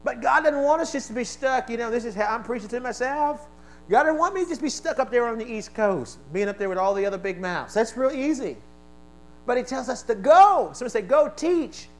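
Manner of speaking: high, loud preacher's cry; full-force sermon delivery; fiery preaching. Real, not acted. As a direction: preach it hard to the room.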